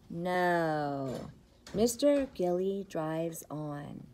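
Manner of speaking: ordinary talk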